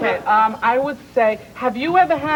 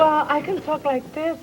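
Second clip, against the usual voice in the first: put-on higher voice; higher register; 6 semitones higher than usual. Shown as a demonstration. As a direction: lift your voice into a higher register. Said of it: "get that voice in a higher register".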